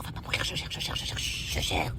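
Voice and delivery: whisper. Acted. Hiding, calming herself.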